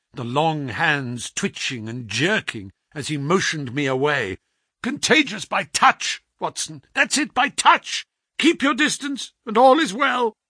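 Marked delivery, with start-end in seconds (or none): 4.8-10.4 much higher urgent warning; feverish urgency. A narrator's reading. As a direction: warn him off urgently. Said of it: "feverish energy".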